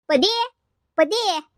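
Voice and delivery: high-pitched call to summon someone. Acted. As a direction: call the name out to fetch her. Mother-in-law arrives calling Paddi.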